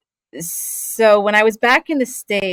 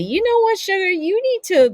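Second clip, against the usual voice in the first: high, lightly voiced fond granddad; granddad's voice; 12 semitones higher than usual. Granddad telling his granddaughter to do commercials, high and fond. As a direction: play granddad fondly, pitched up. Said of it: "he just said to me".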